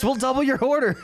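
high, bright TV pitchman hype; home shopping host. Pretend. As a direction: sell it like a late-night infomercial. Mock infomercial pitch.

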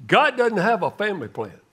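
ordinary talk, nothing marked

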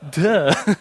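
mock duh. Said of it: "Maybe you could say duh after I'm done saying it".

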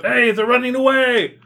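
high, loud shout from the mob; crowd roar. Acted. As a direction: raise the alarm like an angry crowd member yelling. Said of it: "a roar from the crowd goes up".

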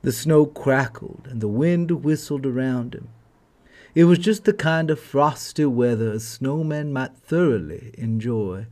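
ordinary delivery

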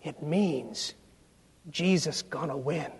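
soft, hushed janitor's words in the student's ear; retold whisper. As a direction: drop your voice to a soft confiding hush. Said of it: "whispered in his ear".

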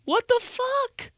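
very high, slow falsetto; acted high-pitched incredulity; mock disbelief. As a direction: squeak it out slowly in falsetto. Acted shock at homeless man's reaction.

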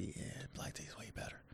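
whispered aside, barely voiced. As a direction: breathe the words out. Retold own thought, whispered.

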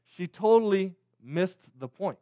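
ordinary talk

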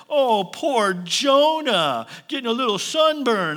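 mock sympathy, drawn out slowly; sarcastic pity. Real, not acted. Preacher mocking sulking Jonah, slow sarcastic pity. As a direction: pretend to pity him, dripping sarcasm. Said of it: "all my sarcasm is here".

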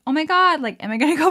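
high, loud mock-panicked cry; retold panic. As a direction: imitate your own panicked reaction. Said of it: "It was traumatic 'cause I was like".

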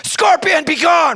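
high, loud banishing shout; commanding shout. Retold or imitated. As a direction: bellow it like casting out a pest. Wife commanding the scorpion, acted.

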